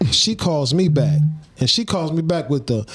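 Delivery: ordinary talk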